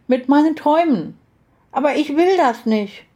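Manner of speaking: put-on high, protesting child voice; upset little girl. A narrator's reading. Little girl explains her dreams to aunt, upset child voice. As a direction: protest plaintively in a little girl's voice.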